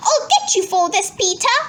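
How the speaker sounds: put-on high, vengeful child's threat; screeched threat. A narrator's reading. Henry threatens his brother Peter, high mock screech. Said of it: "screeched Henry".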